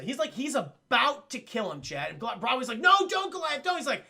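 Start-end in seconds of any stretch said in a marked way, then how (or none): 2.8-3.8 high, loud acted protest; urgent plea. Broadway tries to stop Goliath killing a man, urgent plea. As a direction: call out to stop him, sharply higher.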